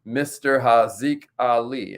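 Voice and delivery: handle repeated slowly; slow, spelled-out handle. Shown as a demonstration. Host repeating his handle for followers, slow and clear. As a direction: draw the name out for the audience. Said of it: "That's my Instagram right there".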